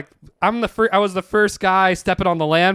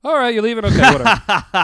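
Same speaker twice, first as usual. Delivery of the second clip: high, loud, offhand dismissal; pitched-up impression of an indifferent older woman; imitated old woman; 7 semitones higher, 8 dB louder than usual. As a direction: brush them off as an uncaring old boss. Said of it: "the old woman was like".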